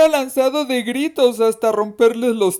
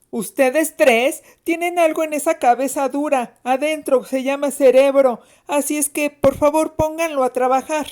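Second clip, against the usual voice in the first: higher-pitched, authoritative schoolmistress voice; mock-authoritative lecture in a raised pitch; stern nun-school teacher; 6 semitones higher than usual. A narrator's reading. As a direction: scold like a severe teacher. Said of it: "imitando el tono de una de las severas maestras".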